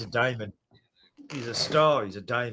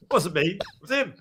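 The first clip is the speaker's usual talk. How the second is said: mock denial; 8 semitones higher, 7 dB louder than usual.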